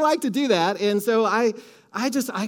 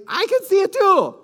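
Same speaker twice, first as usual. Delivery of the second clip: excited declaration; 11 semitones higher, 6 dB louder than usual. Shown as a demonstration. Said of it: "Turn to your neighbor and say".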